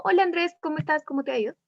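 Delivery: quick, businesslike greeting; serious, passing. Shown as a demonstration. Teacher demonstrating the second tone to the class, serious and passing. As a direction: run through it briskly, just in passing. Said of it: "se sabe que es algo más serio".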